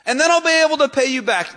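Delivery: high-pitched, loud acted plea; hammed-up begging, pitched high. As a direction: plead desperately as a servant before the king. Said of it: "he's begging the king".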